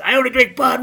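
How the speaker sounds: lightly voiced smug bandleader; mocking bandleader. Joe taunting by naming song.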